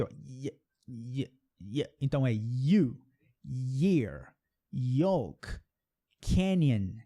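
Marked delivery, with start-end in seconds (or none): 0.0-1.8 y said alone and very softly, drawn out slowly; isolated y sound. Shown as a demonstration. Teacher showing students the bare y sound. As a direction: softly hold the y glide on its own. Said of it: "ela tem esse som aqui".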